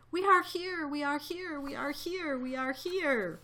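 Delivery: high, thin chanting cry, slowing at the end; many tiny voices calling out; crying out in fear. A narrator's reading. Townspeople proving they exist, crying out in fear. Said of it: "his people cried loudly".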